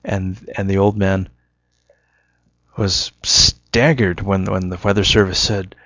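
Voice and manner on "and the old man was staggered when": ordinary talk, nothing marked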